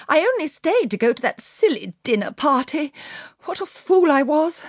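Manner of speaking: high, self-reproachful wail; distressed cry. A narrator's reading. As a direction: lament it in a high, agitated voice. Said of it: "she cried".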